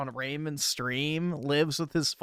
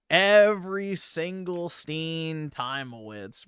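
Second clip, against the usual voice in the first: usual speaking voice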